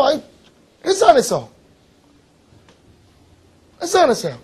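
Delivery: high-pitched, indignant questioning; exasperated scolding. Real, not acted. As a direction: demand an answer from forgetful students. Teacher scolds students who forgot words. (높은 목소리로 답답해하며 다그치기)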